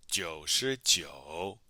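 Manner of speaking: very slow, syllables stretched out; slow model pronunciation. Shown as a demonstration. Teacher answering how to say ninety-nine, very slow model pronunciation. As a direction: draw each syllable out for learners.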